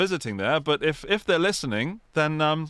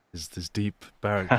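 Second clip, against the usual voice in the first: a hint of a lower baritone, lightly done; mock deep baritone; 7 semitones lower than usual. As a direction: drop into a playful deep voice to tease. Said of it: "bathe in his voice tonight".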